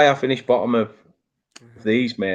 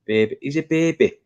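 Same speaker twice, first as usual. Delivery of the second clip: lightly attempted Irish accent; Roy Keane; 3 semitones higher than usual. Presenter echoing a pundit's catchphrase, tentative Irish impression. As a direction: have a half-hearted go at an Irish accent. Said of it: "as Roy Keane would say".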